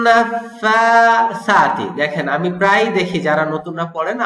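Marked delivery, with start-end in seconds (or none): 0.1-1.9 slow, drawn-out tajweed chanting; measured Quranic recitation. Shown as a demonstration. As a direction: recite it slowly and melodically. Recites the verse.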